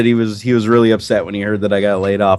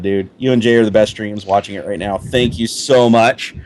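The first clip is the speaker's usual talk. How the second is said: ordinary delivery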